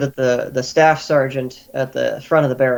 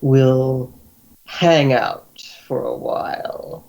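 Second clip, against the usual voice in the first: very slow, drawn-out threat; pause, then stretch the words out; deliberate Guildmaster; much slower than usual. Acted. Guildmaster keeps the captive's young friend, slow and deliberate.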